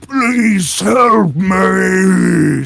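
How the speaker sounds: high, drawn-out scream for help; screaming in pain. Acted. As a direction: scream it out long and loud, in agony. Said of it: "screaming out, ... Screaming out in pain".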